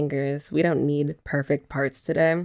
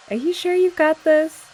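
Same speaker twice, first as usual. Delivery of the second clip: teasing; 9 semitones higher, 5 dB louder than usual. Real, not acted. Teasing partner doing her hair.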